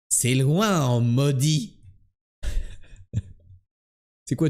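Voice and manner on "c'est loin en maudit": slow imitated Québécois drawl; comic put-on Québécois accent. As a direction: broaden the vowels like a Québécois. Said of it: "quand tu imites les Québécois".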